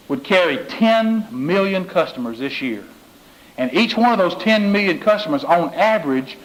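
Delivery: ordinary talk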